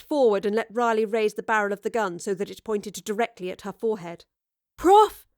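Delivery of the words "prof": high loud call. Acted. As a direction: shout to warn her. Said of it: "Astrid called out".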